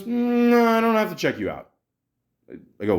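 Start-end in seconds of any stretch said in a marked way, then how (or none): 0.0-1.7 mock-dismissive, pitched up; dismissive doctor. Doctor refuses to check the patient out, dismissive.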